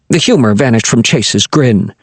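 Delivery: ordinary delivery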